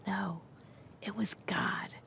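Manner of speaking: soft, reverent whisper. Real, not acted. Teacher whispering the answer to her class.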